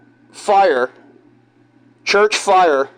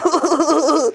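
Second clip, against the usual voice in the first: high giggle; 9 semitones higher than usual. Retold or imitated. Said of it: "holding in his laugh".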